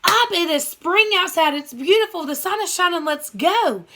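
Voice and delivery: high bright urging; cheerful Frog. Acted. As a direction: rouse him with spring excitement. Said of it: "he was like".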